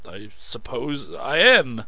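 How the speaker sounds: slow, halting admission; floundering. A narrator's reading. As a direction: stumble over it, caught out. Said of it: "he floundered".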